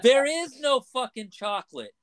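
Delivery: a hammed-up customer finally snapping; high mock-furious customer; exasperated lady. Lady loses patience in joke.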